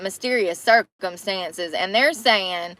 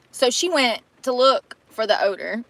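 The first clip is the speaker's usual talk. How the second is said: ordinary delivery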